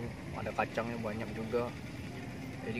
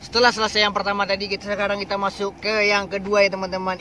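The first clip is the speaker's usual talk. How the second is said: energetic vlog host; 8 semitones higher, 10 dB louder than usual. Acted. Host opens second tasting.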